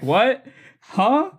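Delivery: high, loud startled huh; baffled. Retold or imitated. Confused by the angry diner, startled what. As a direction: caught completely off guard.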